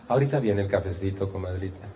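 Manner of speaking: imitating a sugary host; sweet tone to godmother. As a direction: reassure a guest gently, tailing off softly. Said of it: "¿Cambió el tono de voz?".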